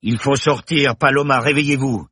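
shout. Acted. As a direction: yell at her to wake up. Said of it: "Bergton hurla". (cri pressant)

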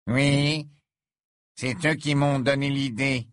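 smug, lopsided grin; said with a twisted smirk; crooked smile. Acted. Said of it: "avec un sourire de travers".

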